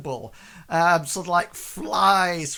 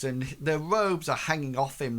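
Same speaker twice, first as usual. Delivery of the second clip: ordinary talk, nothing marked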